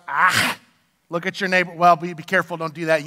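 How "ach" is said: "ah" ending in a throaty Hebrew rasp; "ah" closed by a strong back-of-throat fricative; Hebrew pronunciation. Shown as a demonstration. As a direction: say "ah" with a hard scraped ch at the end. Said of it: "In the Hebrew, it is pronounced".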